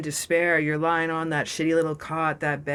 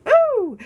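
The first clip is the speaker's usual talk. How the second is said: high, loud playful whoop; excited whoop; 8 dB louder than usual. Real, not acted. Cheer over the lingerie.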